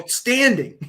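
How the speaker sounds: high, hammed-up Hugo voice; Hugo impression. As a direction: pitch it up, mock the line. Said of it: "he goes".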